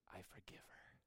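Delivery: a soft unvoiced whisper of forgiveness; whispered I forgive her. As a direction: breathe it out, barely there. Jesus repeats forgiveness softly.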